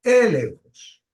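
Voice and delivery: "elenjos" with the first E stressed, drawn out; "elenjos" slow, high first syllable, falling after. Shown as a demonstration. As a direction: hit the opening E of "elenjos", then fall away. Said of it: "con acento en la E".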